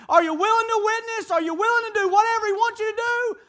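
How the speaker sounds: loud, high, rising altar-call exhortation; fiery preaching. Real, not acted. Preacher builds to full force.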